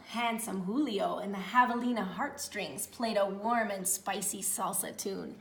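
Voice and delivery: usual speaking voice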